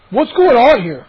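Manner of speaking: loud, indignant question; demanding. Acted. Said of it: "Moose demanded".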